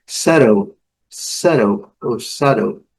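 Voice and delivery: American settle with the T flapped to a quick R or D; teacher showing the American flap T on settle; American English settle. Shown as a demonstration. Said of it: "aquele som que eles chamam de flat T".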